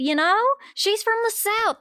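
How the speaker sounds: ordinary talk, nothing marked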